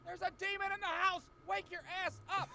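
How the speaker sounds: mock frantic alarm; panicked shouting. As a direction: wake the roommate in terror. Pounding on roommate's door.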